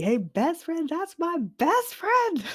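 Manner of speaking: high, loud excited yell; shouting across a party. Acted. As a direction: holler proudly to a friend across the room. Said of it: "just shouting from across the party".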